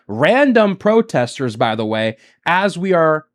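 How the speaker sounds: usual speaking voice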